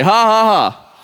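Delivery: deliberate mock laugh, syllable by syllable; demonstrated spoken laugh. Shown as a demonstration. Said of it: "help me today".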